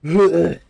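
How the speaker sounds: big loud laugh; loud burst of laughter. Real, not acted. Laughs at own breathlessness.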